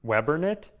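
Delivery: ordinary talk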